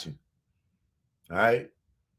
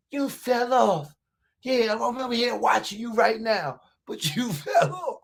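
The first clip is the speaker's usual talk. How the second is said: high mocking taunt; 5 semitones higher than usual. Taunting a commenter on stream.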